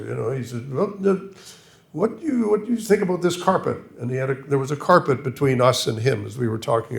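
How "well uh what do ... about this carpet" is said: put-on high, swallowed English accent; Berlin imitation. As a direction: mimic a mumbling Oxford don. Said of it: "I can't quite imitate, but I'll try to".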